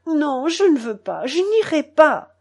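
cling and cry out no. A narrator's reading. Boy clinging to his father's coat, crying out. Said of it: "criai-je en me cramponnant".

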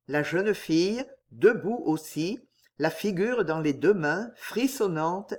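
plain reading voice

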